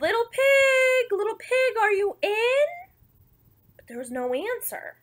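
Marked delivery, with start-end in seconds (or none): 0.0-2.7 high-pitched hail with a questioning lift at the end; calling out. Acted. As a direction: call sweetly into the house, stretching the words. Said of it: "I called".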